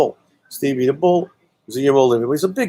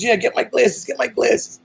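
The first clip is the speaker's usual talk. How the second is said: higher, pleading repeat of the request; urgent plea; 11 semitones higher than usual. Retold or imitated. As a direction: beg for the glasses from down below. Ronald answers from below.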